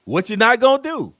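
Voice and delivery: loud, high Southern scold; Southern catchphrase. Shown as a demonstration. Comedian showing the South's favorite warning, bold and loud. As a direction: call it out like a Southern mama. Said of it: "five syllables, one word".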